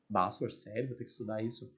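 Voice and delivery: low, soft pupil's grumble; quietly put-upon schoolkid, voice dropped low; reluctant student. Acted. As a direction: mimic a student dismayed at extra work.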